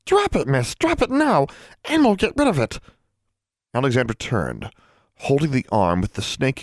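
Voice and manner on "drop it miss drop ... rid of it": high, urgent elf command; alarmed high orders. A narrator's reading. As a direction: order it dropped at once, voice pitched up. Alarmed elf orders girl to drop snake.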